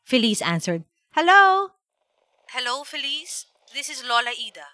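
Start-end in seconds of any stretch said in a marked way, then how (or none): 1.2-1.7 high, loud questioning hello; chirpy child's telephone greeting; child answering phone. Acted. 2.5-4.7 softer grandmother voice down the line; soft-spoken granny caller; grandmother on phone. Acted.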